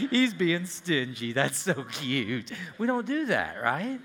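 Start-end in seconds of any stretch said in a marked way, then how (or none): 0.1-2.5 slow, fond mock coo; doting parent. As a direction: drawl it out like a besotted parent. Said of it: "And we go".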